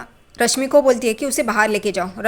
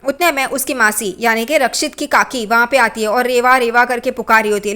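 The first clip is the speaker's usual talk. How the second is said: normal talking voice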